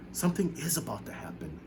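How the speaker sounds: soft, awed murmur; hushed to himself. A narrator's reading. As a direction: say it under his breath. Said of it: "he told himself".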